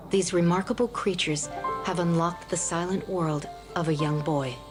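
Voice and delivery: nature documentary. Acted. Documentary narration over music.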